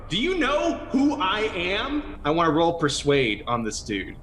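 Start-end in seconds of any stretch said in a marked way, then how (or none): 0.1-2.2 high, imperious demand; pompous booming challenge. Pretend. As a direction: draw yourself up and demand it. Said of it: "have my voice boom up".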